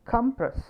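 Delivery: careful demo of "Compress", COM-press; stress on first syllable. Shown as a demonstration. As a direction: hit the opening COM of "Compress". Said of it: "first സിലബിളിലാണ്".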